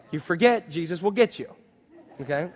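normal talking voice

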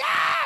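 high mock scream of terror; hammed-up frightened shriek. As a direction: scream as if scared to death. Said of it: "I'm gonna be screaming".

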